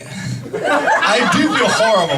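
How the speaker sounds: ordinary delivery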